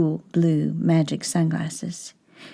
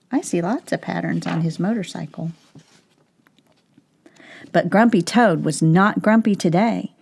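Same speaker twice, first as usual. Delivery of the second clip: ordinary delivery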